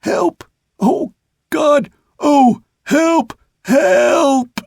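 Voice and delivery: very high, anguished wails; desperate high-pitched pleading, gasp between calls; broken, gasping cries. A narrator's reading. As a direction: cry for help in broken bursts. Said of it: "in gasps".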